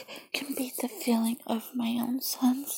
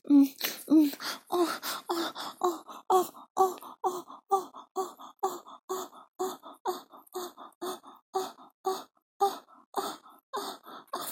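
high, fading breathy moans; escalating soft moans; rising moans; 7 semitones higher, 4 dB quieter than usual. Acted. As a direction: moan higher and softer each time. Building moans in roleplay.

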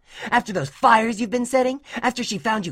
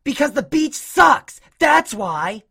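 loud, high angry retort; insistent; 8 dB louder, slower than usual. A narrator's reading. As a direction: insist loudly, high-pitched. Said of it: "Dash insisted".

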